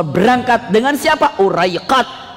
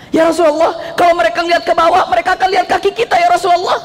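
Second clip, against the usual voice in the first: hurried, higher, worried whisper-free pleading; fearful Abu Bakar; 8 semitones higher, faster than usual. Acted. Abu Bakar warning the Prophet in the cave, hurried and fearful. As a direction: rush the warning like a frightened companion. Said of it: "Itu Abu Bakar takut".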